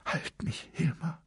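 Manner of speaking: soft whispered plea. A narrator's reading. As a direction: whisper it, trembling. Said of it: "flüsterte sie".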